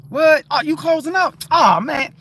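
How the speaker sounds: high, loud mock surprise; upbeat surprise. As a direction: react big with lifted pitch. Acts out surprised cheering up.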